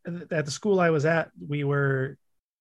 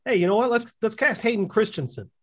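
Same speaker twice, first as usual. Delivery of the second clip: higher, louder mock executive idea; sarcastic pitch; 7 semitones higher, 4 dB louder than usual. Mocking Star Wars casting to a friend, sarcastic pitch. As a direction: pitch it like a clueless producer.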